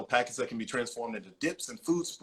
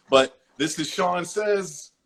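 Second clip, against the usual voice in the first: announcer; 5 semitones higher, 6 dB louder, slower than usual. Acted. Announcing his segment.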